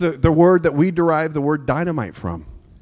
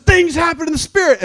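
high, loud preacher's cry; fervent proclamation; 12 semitones higher, 4 dB louder than usual. Retold or imitated. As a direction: proclaim it with fire. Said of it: "I said".

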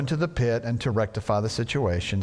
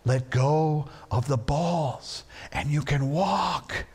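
gentle; slower than usual. Retold or imitated. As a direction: coax her gently. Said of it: "saying gently".

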